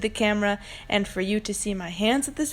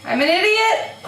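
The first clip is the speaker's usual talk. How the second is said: much higher, exasperated self-reproach; real dismayed outburst; 9 semitones higher, 3 dB louder than usual. Real, not acted. Answering "What?" after the blunder, high exasperated outburst. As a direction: insist on your own blunder in high dismay. Repeats confession of her blunder.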